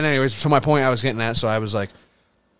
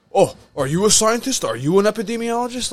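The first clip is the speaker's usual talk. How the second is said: higher mocking voice of a condescending coworker; snooty retort; 9 semitones higher than usual. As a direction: sneer it like a smug coworker. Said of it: "person looks back at me".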